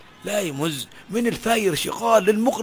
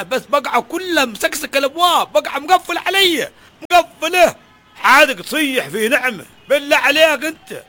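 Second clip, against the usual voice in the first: higher and louder with each line; rising bitter outburst; 7 semitones higher, 5 dB louder than usual. Acted. Father snapping at friend about his blessings, building anger. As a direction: build from grumble to a loud complaint.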